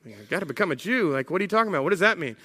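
quick, baffled questioning; mildly incredulous, rapid comeback; puzzled disbelief. Acted. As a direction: fire back confused questions, acted. Convert puzzled by the demand.

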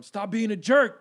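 sharp, loud scolding; stern shout. Retold or imitated. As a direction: snap it out like an angry dad. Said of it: "where this phrase came from".

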